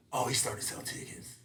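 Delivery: whispered realization; inner thought. Retold or imitated. Realizing Kevin sells tickets, whispered to himself. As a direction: breathe it as a private thought. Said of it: "That's what I was thinking".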